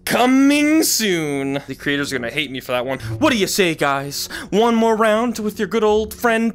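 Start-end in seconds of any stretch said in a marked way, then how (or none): 0.0-1.6 "Coming" said slowly with a long, doubled M; drawn-out double M. Shown as a demonstration. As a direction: linger on the M in "Coming". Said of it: "does say with the two M's".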